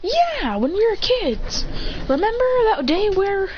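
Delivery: high, drawn-out reminiscing; acted eagerness. Excited reminder of childhood.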